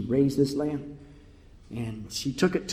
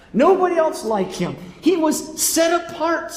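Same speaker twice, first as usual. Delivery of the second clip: high, raised, sustained sermon cry; preaching at full force; 12 semitones higher, 7 dB louder than usual. Real, not acted. Preacher proclaiming God's only begotten son, fervent full-force preaching. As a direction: proclaim each phrase with rising fervour.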